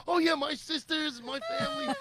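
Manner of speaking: mocking her story. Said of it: "And I went".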